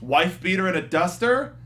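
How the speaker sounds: ordinary talk, nothing marked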